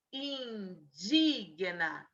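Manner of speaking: indigna drawn out slowly with a plaintive whine; slow mocking of the wrong spelling. Shown as a demonstration. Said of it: "A maneira como ele escreveu".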